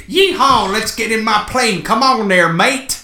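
high, loud hammed-up cowboy American with British slips; mixed American-British accent. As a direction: yell it like a Texan who turns British at the end. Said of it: "got his British and American mixed up".

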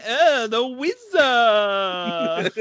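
slow, drawn-out goofy wizard voice; mock wizard voice. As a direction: stretch "Oh, the wizard" out slowly, like a silly old wizard. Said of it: "getting all of my acting out".

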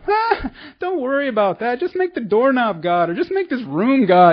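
high, airy and unbothered; light and breezy. Retold or imitated. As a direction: toss it off like it's nothing. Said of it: "he's, like, so light about it".

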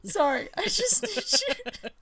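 high-pitched, helpless giggling through the words; real laughing. Real, not acted. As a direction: crack up while trying to apologise. Breaks up laughing mid-reading.

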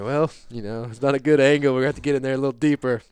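shouting quick orders over the struggling cow; urgent retold instructions. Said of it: "I was like".

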